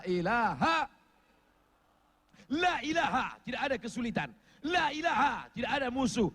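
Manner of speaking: high, rhythmic declamation; full-force preaching. Real, not acted. Preacher proclaiming the greatest zikir, full-force preaching. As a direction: hit each refrain high, then drop for the answer.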